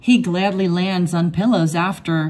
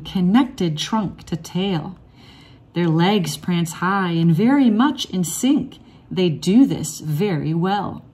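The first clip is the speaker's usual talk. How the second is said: ordinary delivery, nothing marked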